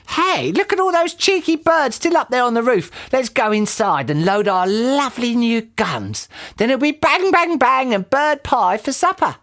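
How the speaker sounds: high-pitched, gleeful villainess; Missus Twit's gleeful voice. A narrator's reading. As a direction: relish the hunt in a high voice.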